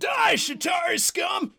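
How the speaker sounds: high, loud battle shout; battle cry. Acted. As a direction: yell it high as an attack. Attack cry.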